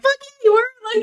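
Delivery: high, loud mock snap; mock outburst. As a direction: hurl it back, hammed up. Retold blunt retort.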